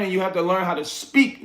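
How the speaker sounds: ordinary talk, nothing marked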